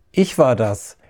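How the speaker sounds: usual speaking voice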